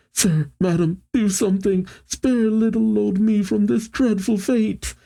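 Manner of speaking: high-pitched mock panic; hammed-up pleading in a high cartoon voice; freaking out. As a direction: plead for rescue like a panicking coward. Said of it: "Caribou starts freaking out".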